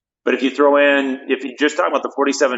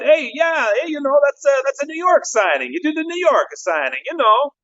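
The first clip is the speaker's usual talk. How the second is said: much higher, breezy put-on voice for the lawyer; mock lawyer voice; 13 semitones higher than usual. Retelling the lawyer's excuse to the podcast host, in a much higher mock voice. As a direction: brush off the worry like a slick lawyer. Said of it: "I don't know why I talk like that".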